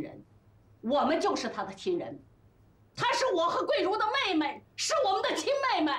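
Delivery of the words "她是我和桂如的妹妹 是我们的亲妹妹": high, emphatic protective anger; indignant defence. Acted. Friend defends the wife as his own sister, indignant. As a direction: stand up for her, pitch raised high.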